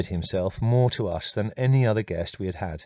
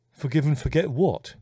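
put-on a puzzled question from a boy who lost the thread; bewildered; 5 semitones higher than usual. A narrator's reading. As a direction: ask it genuinely baffled.

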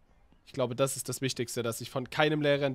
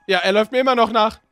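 being chased; 5 semitones higher, 9 dB louder than usual. Acted. Carrying teacher to lake.

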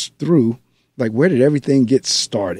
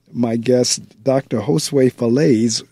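ordinary talk

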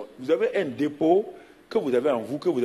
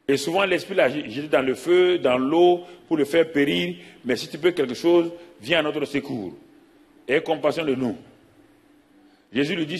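scripture reading; 3 dB louder than usual. Acted. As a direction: read the Gospel out, a bit louder and declaiming. Reads the Gospel passage aloud. (lecture de l'Évangile proclamée, un peu plus fort)

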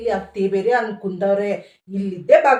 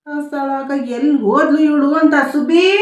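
calling out; 4 semitones higher, 3 dB louder than usual. Acted. Mother-in-law calling deaf daughter-in-law.

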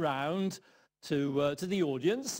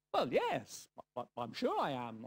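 softer, faltering reply; unsure; 4 semitones lower, 4 dB quieter than usual. Retold or imitated. Young man answering the hippie, faltering and unsure. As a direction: stumble over the words, not convinced. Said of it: "not feeling at all sure".

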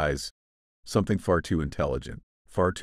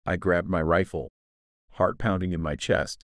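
ordinary delivery

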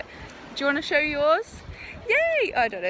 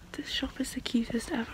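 whispering; 12 dB quieter than usual. Real, not acted. Whispering inside a quiet shop.

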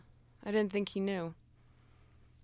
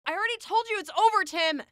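raised, higher-pitched angry protest; angry refusal; 6 semitones higher, 8 dB louder, faster than usual. Acted. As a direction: shout him off, fast and fed up. Acted breakup quarrel.

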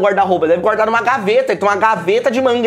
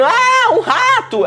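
mock scream; 12 semitones higher, much slower than usual. Minnie spotting a mouse on the floor, shrieking. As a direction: shriek like a scared cartoon mouse. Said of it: "ela tá gritando".

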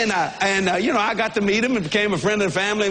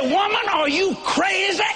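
exasperated shout; 10 semitones higher, slower than usual. Retold or imitated. Husband yells back at his jealous wife, drawn out. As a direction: throw it at her, exasperated. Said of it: "I said".

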